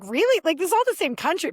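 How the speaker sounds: high, loud incredulous "Really?". Retold or imitated. Speaker to grandmother, loud incredulous retort. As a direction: scoff it in disbelief.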